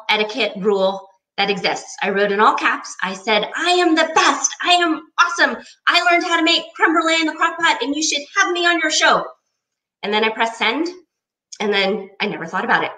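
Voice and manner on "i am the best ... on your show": bragging at a much higher pitch; cocky boasting. Retold or imitated. Reading her cocky email to the show, bragging. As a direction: brag like a cocky all-caps email. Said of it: "So I got a little cocky".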